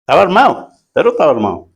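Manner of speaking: ordinary delivery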